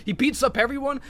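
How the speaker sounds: ordinary talk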